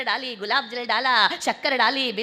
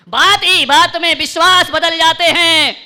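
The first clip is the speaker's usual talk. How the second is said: high, loud, slow proclamation; ringing shout to the whole hall; full-force recitation; 6 semitones higher, 13 dB louder, slower than usual. Real, not acted. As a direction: belt the couplet out slowly. Couplet repeated at full force.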